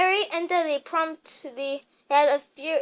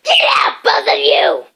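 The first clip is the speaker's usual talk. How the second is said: loud hoarse croaking shout; croaked; 11 semitones lower, 14 dB louder than usual. A narrator's reading. Uncle Vernon croaks at the boys to get out. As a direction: croak it out in a rage. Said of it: "croaked Uncle Vernon".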